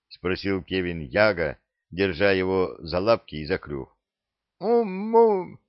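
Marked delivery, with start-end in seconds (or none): 4.6-5.6 muffled parrot hum. Acted. Parrot with beak held shut.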